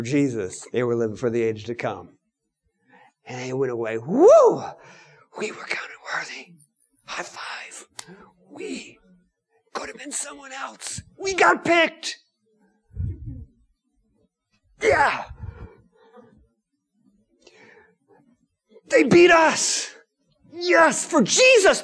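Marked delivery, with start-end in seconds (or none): 4.0-4.6 high, loud celebratory "woo"; joyful whoop. Acted. Apostles leaving the council rejoicing, joyful whoop. 5.4-11.0 very soft, whispery celebration; hushed elation. Acted. Apostles leaving after being counted worthy, hushed elation. 11.2-21.8 high, strained cry of joy; giddy elation. Acted. Apostles rejoicing that they were beaten for Jesus, giddy elation.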